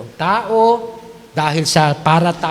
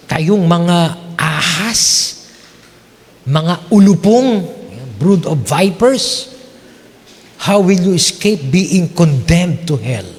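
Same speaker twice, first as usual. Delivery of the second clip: stern Jesus rebuke; slower than usual. Acted. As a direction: thunder it like a prophet's curse. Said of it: "nakakatakot magsalita ang ating Panginoon". (mabagal at malakas na pagsumpa ni Hesus)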